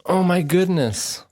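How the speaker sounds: sudden high gasp of remembering; surprised gasp. Real, not acted. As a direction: snap back in shock, slowly.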